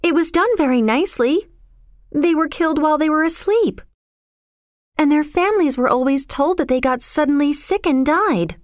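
put-on airy, untroubled girl character; matter-of-fact young girl. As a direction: explain horrors lightly in a high girl's voice.

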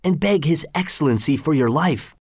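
ordinary delivery

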